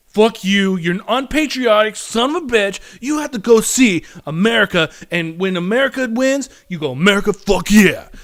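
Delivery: loud mock-patriotic tirade; hammed-up angry rant, punched out loud; indignant patriot. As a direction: berate them like an outraged flag-waver. Acts out indignant patriotic moviegoer.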